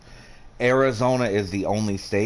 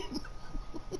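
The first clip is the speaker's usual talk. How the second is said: silent laugh; 16 dB quieter than usual. Real, not acted. Laughing at the story.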